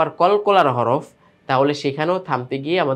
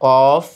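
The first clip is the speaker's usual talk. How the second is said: কফ said louder, with a hard bounce; কফ hit firmly as an example; stressed; 5 dB louder than usual. Shown as a demonstration. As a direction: punch কফ with a clipped echo. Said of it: "কি কি?".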